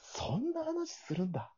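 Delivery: high, slow and soft; high drawn-out voice. Shown as a demonstration. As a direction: stretch it out in a high voice. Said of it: "そんな話するんだっていうね".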